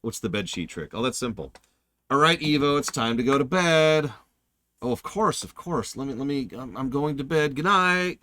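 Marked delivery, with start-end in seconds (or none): 2.1-4.1 louder, higher parental call; acted parent. Parent sending a kid to bed, mock calling out. Parent voiced in bedtime story. 4.8-8.2 put-on higher, eager-to-please child; acted obedient kid. Kid fooling a parent at bedtime, mock-innocent.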